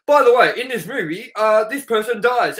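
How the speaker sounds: a hammed-up ticket guy casually dropping a spoiler. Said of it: "could be like".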